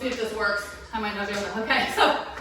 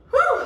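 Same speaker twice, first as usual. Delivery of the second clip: breathy whew; 11 semitones higher, 6 dB louder than usual. Real, not acted. Catching breath after round.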